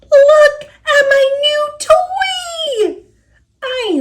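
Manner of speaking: high, loud and slow, bursting with pride; high excited piggy voice. Acted. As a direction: show off the new toy, thrilled. Piggy shows off new toy.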